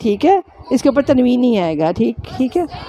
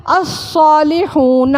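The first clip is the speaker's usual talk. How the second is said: "الصالحون" drawn out slowly, every syllable clear; slow spelled-out reading; 2 semitones higher, much slower than usual. Shown as a demonstration. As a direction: read "الصالحون" slowly, as if for students to repeat. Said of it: "کس طرح اسم معرفہ ہے؟".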